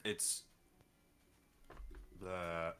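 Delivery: normal talking voice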